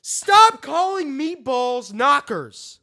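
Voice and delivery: high, loud and slow mock complaint; high, loud, slowed-down mock rant; mock outrage. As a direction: protest in hammed-up outrage. Mock outrage at British slang.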